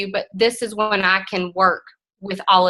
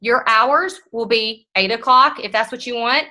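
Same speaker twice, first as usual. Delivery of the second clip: ordinary talk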